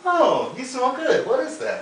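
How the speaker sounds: mock a gushing admirer; over-the-top sweet swoon.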